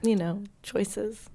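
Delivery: soft, slow, trailing off; hushed embarrassed voice. Acted. Whispering beside sleeping man.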